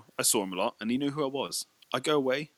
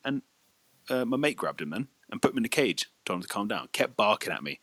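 usual speaking voice

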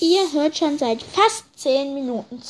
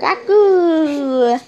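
put-on loud baby babble; baby talk; 7 dB louder than usual. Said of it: "Ich kann sehr gut Babysprachen". (laute Babysprache)

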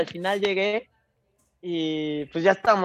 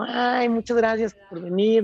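imitating her fiancée; 6 semitones higher than usual. Imitating her fiancée greeting friends.

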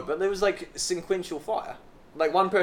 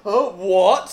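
a loud, higher mock confused "Oh, what?"; mock dazed listener; 4 semitones higher, 11 dB louder than usual. Friend mocking a clueless listener, mock confused. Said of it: "you're like".

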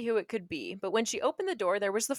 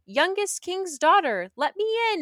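frog calling at the door to be let in; 9 semitones higher, 4 dB louder than usual. Acted. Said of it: "a voice crying".

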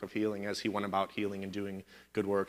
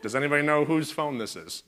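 calling out to the room; 6 semitones higher, 4 dB louder than usual. Acted. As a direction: raise the voice, higher and louder, asking everyone. Bob asks the room about phone.

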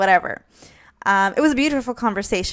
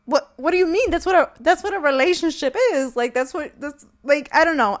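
much higher, pleading disbelief; exasperated retort; 6 semitones higher than usual. Retold or imitated. Quoting herself to her boyfriend, exasperated and high. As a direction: throw up your hands. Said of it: "and I was just like".

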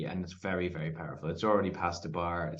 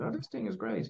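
impressed dad; 13 semitones higher, 4 dB quieter than usual. Retold or imitated. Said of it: "he was".

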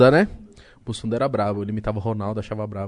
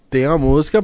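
ordinary talk, nothing marked

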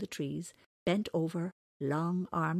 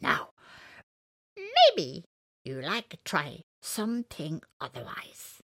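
cartoon bird voice; 4 dB louder, slower than usual. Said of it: "said the little bird".

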